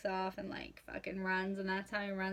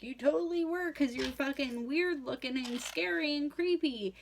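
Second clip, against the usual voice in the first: high, louder mock accusation; teasing outrage, higher and louder; 6 semitones higher, 4 dB louder than usual. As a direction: call him out, pitched up. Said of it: "And I'm like".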